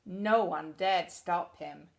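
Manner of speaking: ordinary delivery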